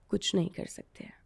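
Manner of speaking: a helpless whisper; the father whispering his resignation. Retold or imitated. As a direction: breathe it out without voice, defeated. Father's helpless reply retold.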